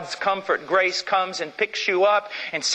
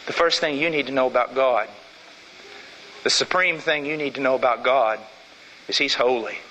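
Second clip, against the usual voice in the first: low solemn emphasis; 6 semitones lower than usual. Real, not acted.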